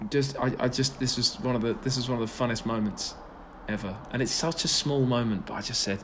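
usual speaking voice

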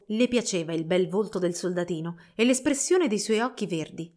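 ordinary delivery

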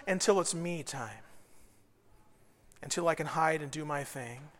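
ordinary talk, nothing marked

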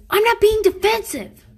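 high, loud indignant yell; defensive yell. Retold or imitated. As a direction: shout it back defensively. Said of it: "But she yells it, obviously, defensively".

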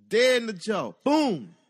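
loud, excited punch call; loud punch impact. Retold or imitated. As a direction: shout the hit, loud and high. Punch in the story.

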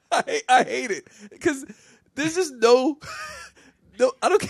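high, loud laughing through the words; can barely get it out for laughing; laughing helplessly, high and loud; laughing hard. Real, not acted. Laughing fit while telling.

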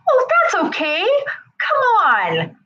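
high-pitched brush-off reassurance; dismissive comforting. Acted. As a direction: hurriedly coax them out of being upset. Acting quick cheering-up of upset child.

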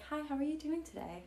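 high, chirpy service voice; bright fake-polite greeting; customer service voice. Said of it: "you're like".

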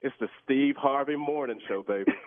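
normal talking voice

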